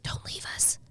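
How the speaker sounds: breathless whispered plea. Real, not acted. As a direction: whisper it, begging. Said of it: "begging friend not to go".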